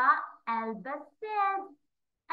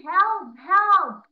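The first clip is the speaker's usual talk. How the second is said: loud, high cry of Help; shout for help; 6 semitones higher, 13 dB louder than usual. Acted. Teacher reading, Alba cries out for help loudly. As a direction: yell for help in alarm. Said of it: "ألبا فجأة صارت تقول".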